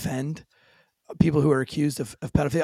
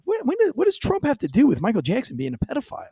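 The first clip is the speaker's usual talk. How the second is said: incredulous retort; 9 semitones higher than usual. Retold or imitated. Retold incredulous reply.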